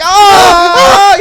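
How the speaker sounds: high, loud imitation scream; hammed-up wailing screams; mock screaming. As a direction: shriek like she's under attack. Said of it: "Yeah, she's screaming".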